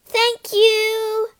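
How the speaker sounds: high, loud call over the wind. Acted. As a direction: shout thanks as you ride off. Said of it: "called Asta".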